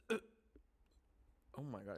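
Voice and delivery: raspy smoker's voice; hoarse, like a smoker. Real, not acted. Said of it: "I sound like a fucking smoker".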